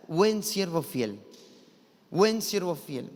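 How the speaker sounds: buen siervo fiel said slowly, each word weighed; slow solemn phrase. Shown as a demonstration. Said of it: "se pronuncia esta frase". ("buen siervo fiel" lento y solemne)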